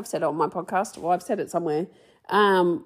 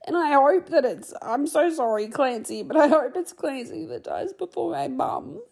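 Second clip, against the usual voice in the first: higher voice, real sadness welling up; tearful; 5 semitones higher than usual. Real, not acted. Host apologising to the dog Clancy, tearful. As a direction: plead apologetically through tears. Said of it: "And I'm just sad".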